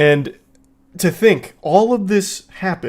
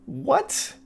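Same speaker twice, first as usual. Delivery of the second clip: incredulous what; 9 semitones higher, 7 dB quieter than usual. Real, not acted. Reacting in disbelief.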